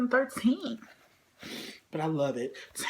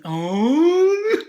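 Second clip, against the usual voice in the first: delighted shout; 7 semitones higher, 11 dB louder than usual. Real, not acted. Sudden delight.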